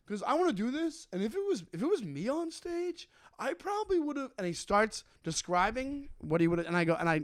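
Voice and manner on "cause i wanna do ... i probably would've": heckler voice. Retold or imitated. Heckler voiced in retold story.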